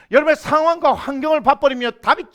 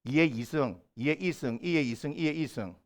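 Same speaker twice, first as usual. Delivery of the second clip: low, soft Gyeongsang tone contrasts; Gyeongsang accent demo; 9 semitones lower, 12 dB quieter than usual. Shown as a demonstration. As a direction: recite it quietly in Gyeongsang dialect tones. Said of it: "경상도, 사람들이 서울 사람들 놀릴 때 쓰는 게".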